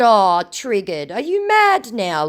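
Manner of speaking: high, slow, loud teasing; mock sympathy. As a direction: goad like teasing a baby. Teases the triggered scammer.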